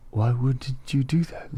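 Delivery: Brad Pitt-style slow disdain; mock condescension, slowly; slow, condescending. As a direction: imitate a movie star talking down, words drawn out. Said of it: "slower, but, like, condescending".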